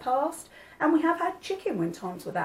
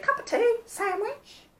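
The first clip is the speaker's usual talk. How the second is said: high squeaky mock mum offering food; 13 semitones higher, slower than usual. Mum greeting a guest with food, mock high squeak. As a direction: pitch way up like a fussing feeder mum. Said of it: "She didn't quite, quite talk like that".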